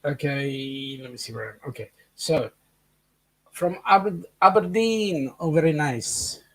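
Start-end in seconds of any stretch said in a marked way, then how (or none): 3.5-6.4 put-on quick Scottish accent; a bad, hammed-up Scottish accent. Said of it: "Terrible Scottish accent I've got there".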